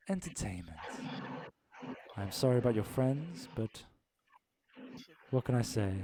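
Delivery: lightly voiced, whispering queen of the arena; a hint of a smug otherworldly woman; mysterious woman. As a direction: purr it like a cold hostess. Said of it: "she kinda looks and goes".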